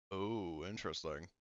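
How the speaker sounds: ordinary talk, nothing marked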